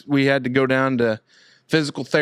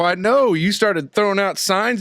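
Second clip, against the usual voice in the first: retold teasing; 7 semitones higher than usual. Retold teasing about hand signs.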